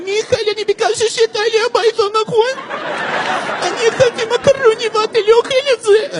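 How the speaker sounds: very high Russian mother, weepy; mock crying middle-aged Russian woman; whiny crying voice. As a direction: scold the child in a tearful high-pitched voice. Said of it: "לקול בוכה".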